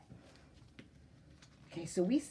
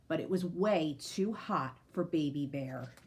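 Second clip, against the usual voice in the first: ordinary delivery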